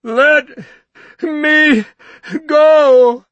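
very high, loud, drawn-out bawl; a shrill desperate bawl. Acted. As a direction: bawl like a frightened child. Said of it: "Norton bawled".

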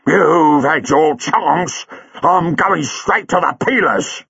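slow, pointed, high angry cabbie; angry parting threat. A narrator's reading. Buller leaving Chang, slow and angry. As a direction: hurl the threat on the way out.

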